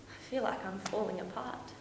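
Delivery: soft, spent admission; hushed and crumbling; quiet despair. Acted. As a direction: let it out small after an outburst. Spent after the outburst.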